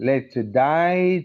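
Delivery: very slow "to die", vowel opened down to "eye"; downward vowel. Shown as a demonstration. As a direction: stretch "die" wide for "day". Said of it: "So die is a downward". ("die"లో కిందికి దిగే అచ్చు, సాగదీసి)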